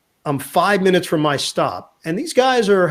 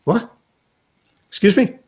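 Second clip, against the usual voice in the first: higher, rising questions; puzzled; 6 semitones higher than usual. Retold or imitated. Newspaper reader wrongly praised as broadcaster, puzzled. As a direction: imitate a baffled stranger, voice tipping up. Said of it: "this guy is like".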